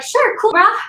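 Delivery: the ant agreeing in a lightly voiced casual dude voice; laid-back ant. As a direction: chill ant saying sure. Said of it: "And so he's like".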